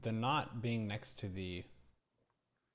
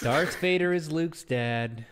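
mock dramatic reveal; 7 semitones higher, 11 dB louder, slower than usual. Mock spoiler joke.

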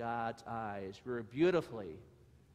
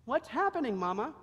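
much higher, louder small donkey voice; a frightened little foal asking his mama; young donkey asking mama what's happening; 12 semitones higher, 9 dB louder, faster than usual. Acted.

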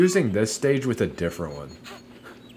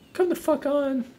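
frustrated outburst. Real, not acted. Frustrated at missing a buff.

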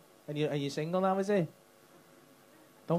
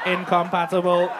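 robot voice; 4 semitones lower, 8 dB louder than usual. Retold or imitated. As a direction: echo it back like a robot. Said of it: "say that like a robot".

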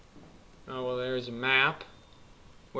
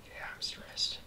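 whispering; 12 dB quieter than usual. Real, not acted. Admitting stress.

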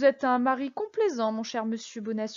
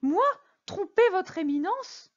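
higher, slow, incredulous protest; shocked protest; 7 semitones higher, slower than usual. A narrator's reading. Prisoner denying he deceived the cardinal, high slow incredulous protest. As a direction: protest innocence in disbelief. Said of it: "s'écria Bonacieux".

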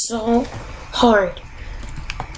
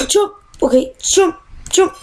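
urgent jump calls; 7 semitones higher than usual. Real, not acted. Urging character to jump.

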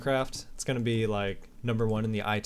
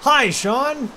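loud, high shout of Hi, Sean; shouting greeting; 12 semitones higher, 9 dB louder than usual. Acted. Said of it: "and shouts".

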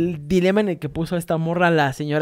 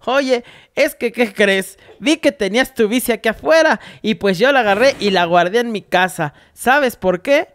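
higher, unhurried Yucatecan neighbor; Yucatecan accent; 4 semitones higher than usual. Retold or imitated. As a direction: keep the old lady's Yucatecan lilt going. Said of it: "y la doña me dice". (acento yucateco de la vecina, entre risas)

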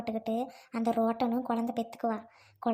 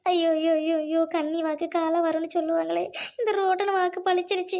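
high, loud wailing of aiyo aiyo; loud lament; 5 semitones higher, 5 dB louder than usual. Acted. Mother lamenting to her sister that the curse came true, loud wail. Lamenting after accident news.

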